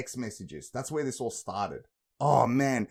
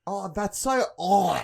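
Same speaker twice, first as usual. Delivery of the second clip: slow, high, louder gagging whine; gagging girl impression; 7 semitones higher, 6 dB louder, slower than usual. Mocking a girl who gags on her slang, slow and high. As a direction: sound like choking mid-word. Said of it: "Right, exactly, just like that".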